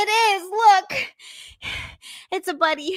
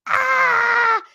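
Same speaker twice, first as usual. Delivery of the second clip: mock scream; 10 semitones higher, 6 dB louder than usual. Mock fright at raccoon slippers.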